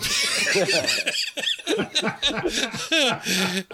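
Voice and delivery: long, high-pitched laugh; uncontrolled belly laugh; real laughing fit. Real, not acted. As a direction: crack up at a favorite joke. Cracking up over remembered film line.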